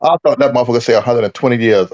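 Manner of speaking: usual speaking voice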